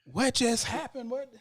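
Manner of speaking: high-pitched, baffled retold reaction; stunned disbelief. Fan reliving the moment he lost it, stunned disbelief. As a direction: pitch it way up, as if you misheard. Retelling his shock at the pick.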